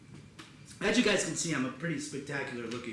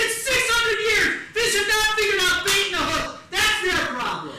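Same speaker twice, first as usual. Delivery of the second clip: loud, high-pitched comic rant; outraged, hammered-out yelling; exasperated shouting; 11 dB louder than usual. Acted. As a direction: argue at the top of your voice. Shouted argument in fishing story.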